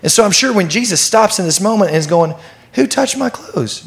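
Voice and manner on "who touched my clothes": high searching question. Retold or imitated. Jesus stops and asks the crowd.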